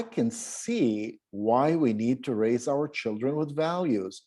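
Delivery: slow, with "values" falling at the end; one-breath phrase reading. Shown as a demonstration. Teacher models number four in one breath, slow. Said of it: "this is the kind of practice".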